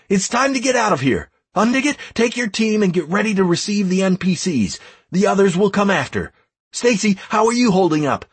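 high, urgent announcement; calling out orders. A narrator's reading. Leader announcing the retreat to everyone, calling out orders.

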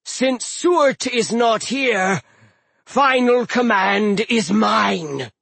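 slow booming giant yell. Acted. Hrym shouts.

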